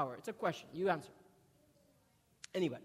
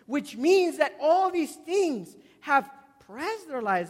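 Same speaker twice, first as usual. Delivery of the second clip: louder, slow, hammering each line; forceful preaching; 3 semitones higher, 7 dB louder, slower than usual. Acted. Preacher driving home the point to church, loud and slow. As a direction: pound the point home to the congregation.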